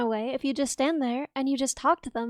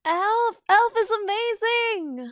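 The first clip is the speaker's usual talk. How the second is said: excited; 7 semitones higher, much slower than usual. Real, not acted. Excited about a movie.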